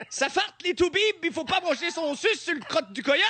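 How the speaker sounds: exaggerated Québec French twang, high and loud; heavy Québécois accent. Shown as a demonstration. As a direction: ham up a rural Québécois accent. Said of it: "un léger accent".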